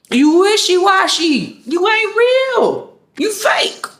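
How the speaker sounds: high, drawn-out mock call-out. Calling out a fake friend, high mock accusation. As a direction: sing out the accusation in a high, stretched voice.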